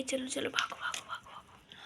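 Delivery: scurry off hushed; whispered run away; hushed hurry. Acted. Fleeing as she wakes.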